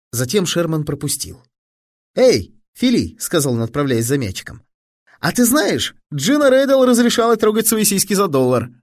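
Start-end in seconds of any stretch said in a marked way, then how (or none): 2.1-3.2 put-on high, loud boyish hail; boy calling. A narrator's reading. Sherman fetching the ball, calling to his friend. 5.2-8.7 put-on high-pitched boyish voice; gossiping boy. A narrator's reading. Fat boy telling his pal gossip, high and boyish.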